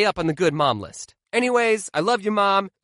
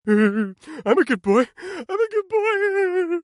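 put-on slow, pleading child voice, rising higher; whiny kid; 11 semitones higher, much slower than usual. Kid begging mom for his candy, slow pleading whine. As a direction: beg like a little kid for candy.

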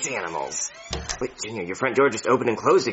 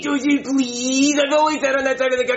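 whiny begging; 11 semitones higher than usual. Acted. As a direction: beg like a spoilt kid. Begging for the seat.

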